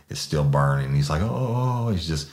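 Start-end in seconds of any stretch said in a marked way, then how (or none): 1.2-1.9 pained moaning. Retold or imitated. Suspect moaning at hose.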